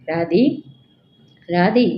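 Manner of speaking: gently rising call to wake her. A narrator's reading. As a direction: call her name, stronger the second time. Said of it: "ఈసారి మరింత గట్టిగా".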